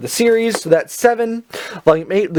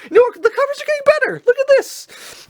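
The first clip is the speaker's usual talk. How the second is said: very high squeaky put-on voice. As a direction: jump way up into a squeak. Excited about cover progress.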